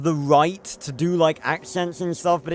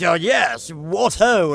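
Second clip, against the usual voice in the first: mock posh British exclamation; 3 semitones higher, 4 dB louder, slower than usual. Shown as a demonstration. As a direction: toss it off like a cartoon English gent. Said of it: "I could do the stereotypical".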